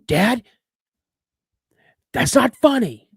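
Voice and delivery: high, loud protest to Dad; indignant yell. Retold or imitated. Scared boy on deer stand yells at dad, indignant. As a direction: call out sharply, pitched well up.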